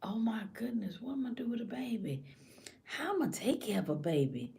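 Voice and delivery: hushed, acted fretting; anxious inner thought. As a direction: fret softly to yourself. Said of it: "All this stuff you start thinking about".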